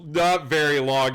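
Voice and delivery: normal talking voice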